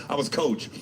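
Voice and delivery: normal talking voice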